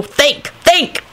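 high, urgent urging. A narrator's reading. Lupin urging Neville to come up with something, urgent.

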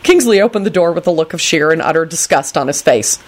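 ordinary delivery

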